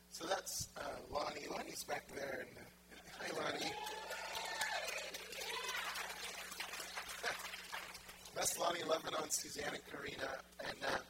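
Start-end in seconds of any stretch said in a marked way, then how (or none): 3.8-8.3 long, high-pitched laugh; real drawn-out squealing laugh; real laughter. Real, not acted. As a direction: laugh freely and at length. Laughs after greeting Lonnie in audience.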